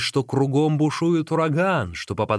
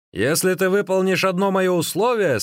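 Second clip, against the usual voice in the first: raised, teasing offer; with a smirk; 6 semitones higher, 4 dB louder than usual. A narrator's reading. Magician offers runner a bargain, with a smirk. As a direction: dangle the condition slyly. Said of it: "сказал тогда с усмешкой Дайдзун".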